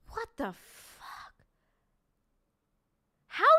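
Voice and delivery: slow, breathless disbelief; stunned whisper. Real, not acted. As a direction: breathe it out, floored. Stunned at settlement.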